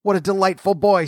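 high, admiring exclamation; delighted Scrooge. A narrator's reading. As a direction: gush happily over the lad.

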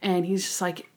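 ordinary talk, nothing marked

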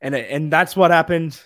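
losing their voice, hoarse and strained; hoarse, worn-out voice. Real, not acted. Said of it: "I'm losing my voice now".

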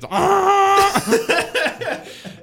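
squealing burst of laughter; high laughter. Real, not acted. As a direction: crack up shrieking. Cracking up mid line.

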